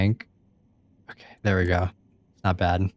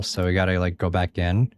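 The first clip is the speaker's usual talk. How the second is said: ordinary delivery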